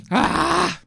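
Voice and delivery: loud, high wail of guilt; remorseful scream. Real, not acted. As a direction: scream out your embarrassment. Guilty outburst after bad joke.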